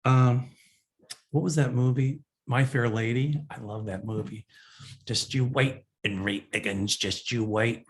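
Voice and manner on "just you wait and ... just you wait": put-on Cockney accent quote. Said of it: "especially Cockney".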